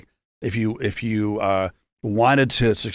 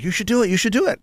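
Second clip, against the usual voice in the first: much higher-pitched mock cheering-on; imitating friends egging him on, pitched way up; eager urging; 11 semitones higher, faster than usual. Friends push him into a comedy contest, eagerly urging.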